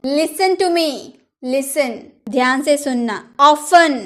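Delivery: ordinary delivery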